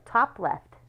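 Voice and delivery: usual speaking voice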